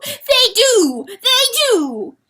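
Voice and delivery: high, loud desperate insistence; frantic cry. Acted. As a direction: shout it in desperation. Rooster frantic outcry.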